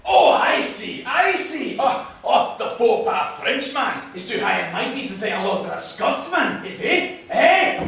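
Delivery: loud, high-pitched indignation; offended and confrontational; angry taunting. Acted. Scotsman taunts snubbing Frenchman.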